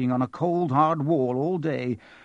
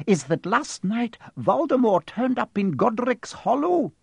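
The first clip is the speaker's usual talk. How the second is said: high, urgent, halting report; anxious, high-pitched disclosure with hesitations; pressing on; 9 semitones higher, 3 dB louder than usual. A narrator's reading. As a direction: press on, faltering before the worst word. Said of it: "she pressed on".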